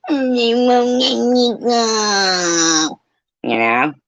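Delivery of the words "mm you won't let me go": high, slow, whiny mock sob; mock crying whine. Mocking a rival who cries, in a whiny voice. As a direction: whimper it, hammed-up and pathetic. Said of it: "Don't be sitting there crying, going".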